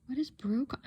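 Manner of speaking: unvoiced whispered puzzling; puzzled whisper. Real, not acted. Puzzling over her own handwriting, in a whisper. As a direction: whisper it to yourself, baffled. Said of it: "Well, that's puzzling".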